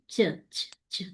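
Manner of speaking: "چ" as a short airy release; escaping "ch" sound. Shown as a demonstration. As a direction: let "چ" puff out on its own. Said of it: "تو کیا آواز نکلے گی؟".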